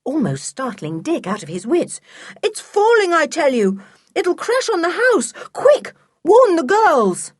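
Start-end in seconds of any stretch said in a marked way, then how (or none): 2.4-7.3 high, urgent yelling; frantic boy raising the alarm; shouting in alarm. A narrator's reading. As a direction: shout the warning at full pitch. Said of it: "Shouting at the top of his voice".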